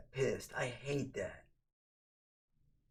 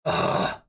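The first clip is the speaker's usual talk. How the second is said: loud voiceless monkey cry; ape screech; 8 dB louder than usual. Acted. Streamer saluting his mom as monkey matriarch, ape screech. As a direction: let out a harsh, loud ape noise. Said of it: "monkey matriarch".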